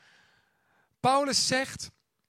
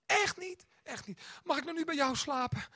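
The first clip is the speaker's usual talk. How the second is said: put-on pleading child; 7 semitones higher than usual. Son pleads to sleep with dad.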